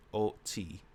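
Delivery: ordinary talk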